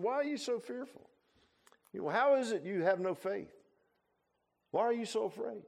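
gently voiced Jesus' question. Preacher as Jesus asking frightened disciples, high mild questioning.

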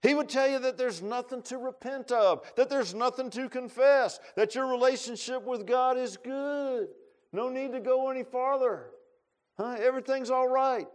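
high, loud hammering preaching; preaching at full force. Real, not acted. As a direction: press each line at the congregation.

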